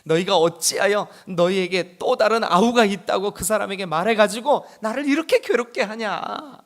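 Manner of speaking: lightly voiced grieving father; Jacob lamenting. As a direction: reproach the sons as the father.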